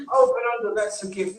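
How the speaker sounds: high-pitched mock gushing; put-on high voice of praise; mimicked admirer. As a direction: imitate someone marvelling at a talent. Mimics admirers praising his accents.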